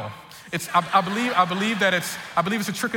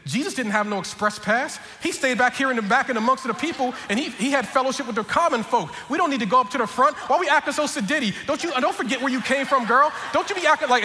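high, worked-up preacher's scolding; mock preaching; 5 semitones higher than usual. Husband improvises an excuse to his wife, mock preaching. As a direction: preach an excuse with rising righteous indignation.

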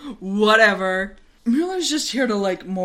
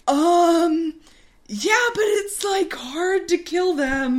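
higher, hesitant soldier voice, drawn-out ending; a meek young soldier raising a worry; hesitant high voice of a nervous officer; timid Mueller; 8 semitones higher, slower than usual. Acted.

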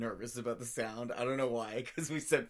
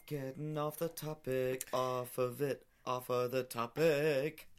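stretched-out playful words; slow teasing drawl; slower than usual. Pretend. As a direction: drag it out, teasing. Teasing about going off topic.

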